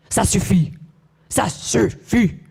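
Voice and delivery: mock growled Ça suffit, the second one slow; imitating her own angry yell in French; growly French scream. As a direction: re-enact the mother snapping at her kids, stretched. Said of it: "my voice goes low and growly".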